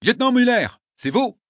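loud, high barked question; barking. Acted. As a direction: bark the name out.

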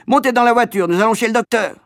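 put-on high, loud call to the children; mother shouting everyone into the car; urgent shout. A narrator's reading. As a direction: cry out orders across the road. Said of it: "elle cria".

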